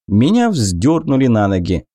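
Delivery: normal talking voice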